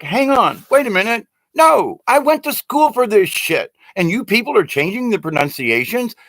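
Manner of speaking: high-pitched, indignant objection; outraged protest. Acted. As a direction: stop them and protest in disbelief.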